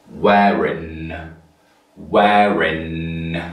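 "wearing" said slowly, ending on n with no g. Shown as a demonstration. Teacher drilling "wearing" for the class. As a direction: let "wearing" close on n. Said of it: "no G, no K".

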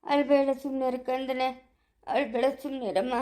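low, slow soothing; tender consoling. Acted. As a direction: gently hush a crying child. Consoling a crying departing bride. (ಮೆಲ್ಲಗೆ, ನಿಧಾನವಾಗಿ ಸಮಾಧಾನ)

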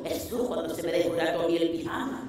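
low, soft muttered aside; under her breath in disbelief; quiet aside. Retold or imitated. Quoted thought at laundry line.

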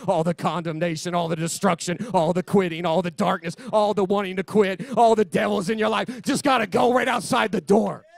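rhythmic list building to a shout; escalating revival-preacher cadence; fervent preaching. Real, not acted.

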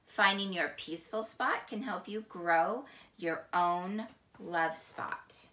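ordinary talk, nothing marked

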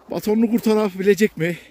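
normal talking voice

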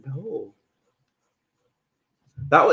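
ordinary talk, nothing marked

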